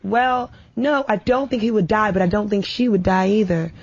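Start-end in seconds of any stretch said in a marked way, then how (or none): none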